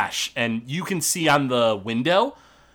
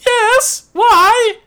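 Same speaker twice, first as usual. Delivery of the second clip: put-on high, loud old lady squawk; old grandma voice; 10 dB louder than usual. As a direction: chirp it back innocently. Grandma answering orc at door.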